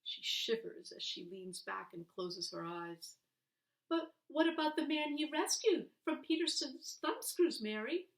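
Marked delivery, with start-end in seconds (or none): 3.9-8.1 put-on a boy's high, pleading voice, louder; boy begging for the rest of the story; young boy pressing his aunt for the story, eager and high. A narrator's reading. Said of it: "Billy asks".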